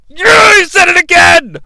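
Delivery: high, loud gleeful yell; excited shout. Real, not acted. Fan cheering the repeated catchphrase, gleeful shout. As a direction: cheer that he said it again.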